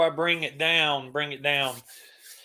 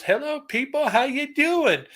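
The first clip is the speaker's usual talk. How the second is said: high-pitched rock frontman impression; 10 semitones higher than usual. Host quoting a viewer's best impression, high frontman voice. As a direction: greet the crowd in a high, put-on star voice. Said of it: "in his best [name] voice".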